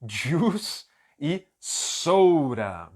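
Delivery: "juice e soda" said very slowly and clearly; slowly fix the words just missed; correção bem lenta; slow correction. Shown as a demonstration. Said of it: "Falei errado essa aqui".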